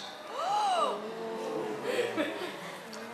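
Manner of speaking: a sharp, high gasp that breaks into a laugh; shocked gasp. Real, not acted. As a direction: gasp as if the rumor is a scandal. Shocked at a licensing rumor.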